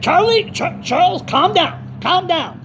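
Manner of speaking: high-pitched retold plea to calm down; imitated pacifying caller. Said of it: "he said".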